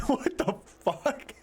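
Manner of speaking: high squeaky laugh into the words; laughing disbelief. Real, not acted. Laughing at chat's wine-bag comment, high and squeaky. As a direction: crack up while asking.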